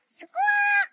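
high, loud parrot screech; shrill bird call; parrot squawk. Acted. Playing a parrot.

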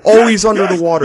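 high, loud gamer outburst; exasperated shout. Real, not acted. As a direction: shout it out in frustration. Exasperated at game situation.

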